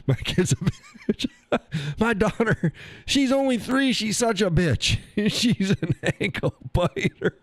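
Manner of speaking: high voice squeaking through laughter; high-pitched helpless laughing talk. Real, not acted. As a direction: giggle the words out, barely holding it together. Joking about a daughter.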